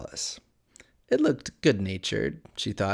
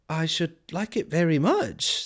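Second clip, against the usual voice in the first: higher character voice; polite Alice; 7 semitones higher than usual. A narrator's reading. Alice answering the Cat's invitation, higher and polite. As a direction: pitch it up, politely.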